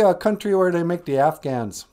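ordinary talk

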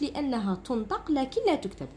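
usual speaking voice

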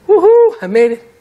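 loud, high mock whoop of victory; mock triumph. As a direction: cheer like you've finally made it. Mock self-congratulation after wall.

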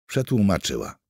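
usual speaking voice

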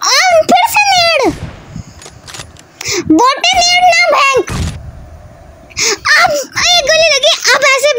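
high frantic gamer shout; panicking gamer. Real, not acted. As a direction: yell at the enemy in alarm. Repeated net attack in game.

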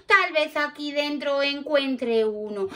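usual speaking voice

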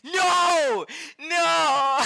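long, high, very loud wails; screamed no. Real, not acted. Streamer reacting to a card pull, screamed no. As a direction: scream it drawn out, breaking toward laughter.

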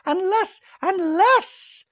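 very high, loud, drawn-out surprise; Dorit impression. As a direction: act shocked in a squeaky put-on voice. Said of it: "Dorit continues in a talking head".

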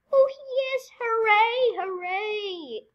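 high loud hooray; cheering. Real, not acted. Gamer cheering the character's victory. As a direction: cheer like you just won.

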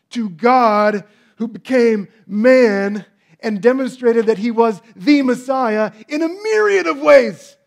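loud, high, slowing sermon climax; preaching at full force. Acted. Preacher encouraging the congregation, loud and high. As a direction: lift the whole room with it.

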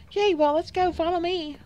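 very high, loud cheer; eager cat lady. Acted. Cat lady leads her new friend off, high and thrilled.

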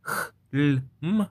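KH, L, M sounded one by one in חלם; letter sounds separately. Shown as a demonstration. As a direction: break חלם into three isolated consonant sounds. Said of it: "מילה שנרשמת".

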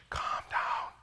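whispered calm down; wife's hushed calm down. Retold or imitated. Wife quietly shushing her husband, whispered. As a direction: hush him like a wife across the room. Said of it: "she's like".

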